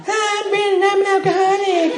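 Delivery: put-on very high, squeaky teen voice; fifteen-year-old newcomer. Fifteen-year-old introducing himself at a meeting, squeaky kid voice. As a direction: squeak it out like a young teenager. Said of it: "I'm fifteen".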